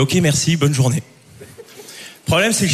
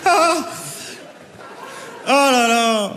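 ordinary talk

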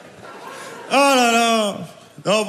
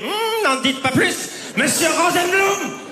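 fawning waiter; 10 semitones higher than usual. Pretend. Acted train waiter replying.

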